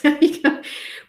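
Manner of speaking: breathy, unvoiced burst of laughter; real laugh. Real, not acted. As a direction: laugh at herself. Laughs at her own hands.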